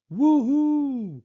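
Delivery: high, loud whoop of joy; a kid's happy woo-hoo yell; excited shout. A narrator's reading. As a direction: cheer it out on a bumpy ride. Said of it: "Pete shouts".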